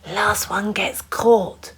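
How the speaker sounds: slow whispered warning. A narrator's reading. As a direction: whisper the dare. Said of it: "whispered Hector".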